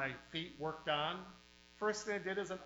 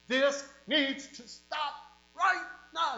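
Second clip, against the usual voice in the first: high, loud, slow pulpit shout; worked-up pastor; 10 semitones higher, 10 dB louder, slower than usual. Retold or imitated. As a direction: bring the hammer down on the room. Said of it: "you all worked up".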